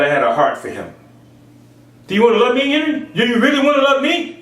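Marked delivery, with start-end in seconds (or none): 2.1-4.4 high, earnest questioning voice; high, earnest voice of God asking; voicing God. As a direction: pitch it up, gently asking.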